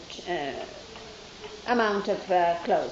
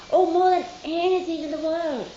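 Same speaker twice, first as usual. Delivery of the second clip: gushing; 6 semitones higher than usual. Retold or imitated. Child gushing her love to Baba.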